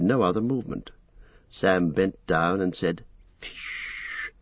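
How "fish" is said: breathy whisper close to the ear; hushed single-word whisper. A narrator's reading. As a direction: whisper it right into a sleeper's ear. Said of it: "close to his ear".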